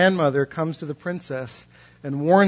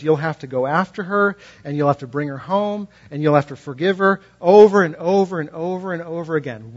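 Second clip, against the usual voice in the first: ordinary talk, nothing marked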